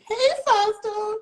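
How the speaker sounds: high, loud, drawn-out hello; loud singsong shout to a newcomer; calling out a greeting. Real, not acted. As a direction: stretch out an excited high greeting. Calls out greeting to joining viewer.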